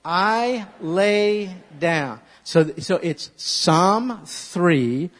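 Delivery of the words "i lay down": slow drawn-out repeat; slow recitation. Shown as a demonstration. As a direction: say it back slowly with them. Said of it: "Say that with me".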